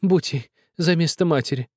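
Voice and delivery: high, begging woman's voice; pleading woman. A narrator's reading. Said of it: "упрашивала Устинья Марковна".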